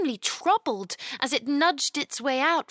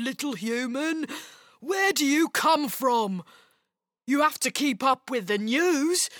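amused ogre scoffing at a human; mocking ogre. A narrator's reading. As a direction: put on a creature voice, teasing. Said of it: "the little man's mocking laughter".

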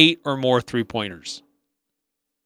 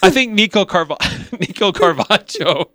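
laughing; 6 semitones higher than usual. Real, not acted. Both breaking up laughing.

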